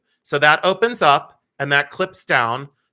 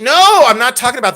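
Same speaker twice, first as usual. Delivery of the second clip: exasperated no; 8 semitones higher, 10 dB louder than usual. Real, not acted. Correcting listener about the doctor story, exasperated. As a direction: cut them off with a loud, high no.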